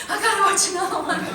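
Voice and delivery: high, loud mock pleading through laughter; laughing, over-eager outburst; hooked binge-watcher. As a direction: blurt it like a giddy addict. Acts out her binge-watching self.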